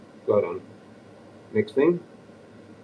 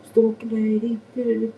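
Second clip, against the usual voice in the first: high, loud, sing-song mock rant; ranting mimicry; 11 semitones higher, 5 dB louder than usual. Replaying his rant to himself, high mock rant. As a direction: parody your own rant, pitched way up.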